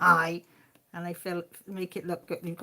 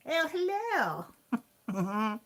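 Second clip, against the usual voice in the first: posh imitation; 4 semitones higher, much slower than usual. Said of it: "So you went very posh".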